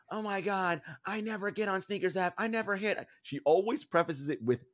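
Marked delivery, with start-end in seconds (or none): 0.1-3.1 high-pitched imitation of a co-host's lament; mock complaint. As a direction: mimic her dramatic whining, pitched up. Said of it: "I'm relaying you what she says".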